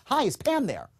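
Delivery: upbeat greeting. Shown as a demonstration. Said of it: "Pam is not gonna buy because I say".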